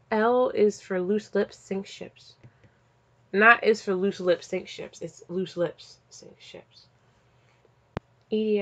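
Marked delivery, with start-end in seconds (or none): 1.6-2.3 hushed whisper of the slogan. Acted. Whispered tagline after slogan. 4.5-5.0 hushed whisper of the slogan. Acted. Whispered tagline repeated. 5.0-6.9 hushed whisper of the slogan. Acted. Whispered tagline again.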